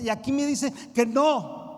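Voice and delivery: loud, slow punch of a line; slow down and hit it hard; loud emphatic preaching. Acted. Pastor imitating the recruit's shock, loud and slow. Peak of sermon.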